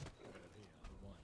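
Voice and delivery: lightly voiced low, casual friend; low offhand brag, mock casual; imitating smug friend. Voices friend boasting of a win.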